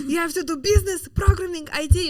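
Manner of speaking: high, loud mock dad voice, commanding; hammed-up dad laying down the law; imitating strict dad. Daughter mocks her father's career orders. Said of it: "He said".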